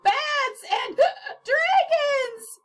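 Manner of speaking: high, loud terrified scream; panicked high shout with gasps. Acted. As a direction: shriek in terror. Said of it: "screamed Mister Penny".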